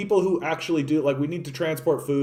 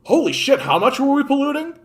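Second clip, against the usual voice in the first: higher, louder mock gasp of horror; imitating a shocked crowd, pitched up; mock shocked realisation; 11 semitones higher, 6 dB louder than usual. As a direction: play the public suddenly horrified. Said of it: "that's what fucking people are gonna say".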